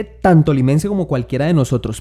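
normal talking voice

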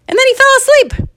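very high, loud exclamation; high comic punchline. A narrator's reading. Storyteller reveals the boy dozed off, high comic exclamation.

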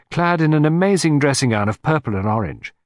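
plain reading voice

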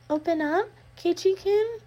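eerie sweet plea at the door; sing-song coaxing. Acted. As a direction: call it sweetly through the crack. Reina coaxing.

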